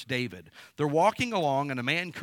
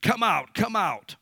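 higher, loud jeering yell; cursing shout; 7 semitones higher, 9 dB louder, slower than usual. Retold or imitated. Shimei cursing King David, loud high jeering shout. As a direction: hurl it at him like a curse from the roadside. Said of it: "thus said Shimei when he cursed".

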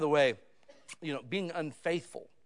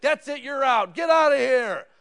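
1 mock shout; 8 semitones higher, 14 dB louder than usual. Imagined father throwing kid out.

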